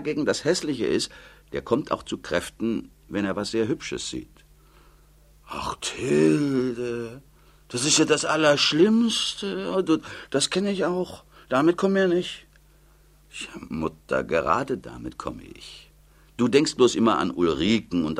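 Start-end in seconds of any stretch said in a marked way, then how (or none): 5.5-12.5 put-on higher, plaintive old mother's voice; fretful old mother. Old mother pleading with her daughter Tilde, higher and plaintive. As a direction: whine it like a worried old mother.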